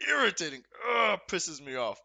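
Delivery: fed up with the bed sheet; annoyed outburst. Real, not acted. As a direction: spit the word out in disgust. Ranting about bed sheets.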